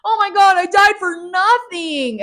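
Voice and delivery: high, loud melodramatic whine; mock Alice wailing that her life is over; whiny Alice. Vlogger mocks Alice's self-pity, high melodramatic whine. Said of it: "Alice is complaining".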